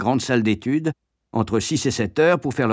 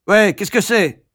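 high, loud barking query; irritable schoolmaster; gruff master; 8 semitones higher, 4 dB louder than usual. Acted. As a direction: snap at the interruption. Master barks at raised hand.

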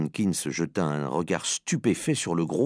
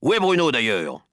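raised, higher questioning voice; put-out father, louder and higher; irritated demand; 6 semitones higher, 5 dB louder than usual. Acted. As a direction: demand it impatiently. Father demands his son.

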